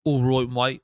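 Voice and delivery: tentative, low-pitched Cockney attempt; Cockney accent. Testing an accent for the co-host, tentative Cockney. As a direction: try out a Cockney accent quietly. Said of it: "if I can do a Cockney accent".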